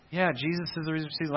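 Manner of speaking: dismissive, hurried recital of a familiar saying; just saying it. Acted. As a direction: rattle it off quickly, without weight. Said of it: "do we just say it".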